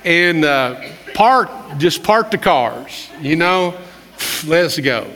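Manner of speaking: mock Moses prayer to part traffic. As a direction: play it like a cheeky bargain with God. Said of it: "I wanted to pray".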